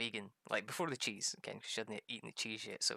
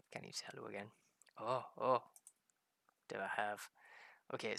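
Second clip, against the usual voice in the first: ordinary talk, nothing marked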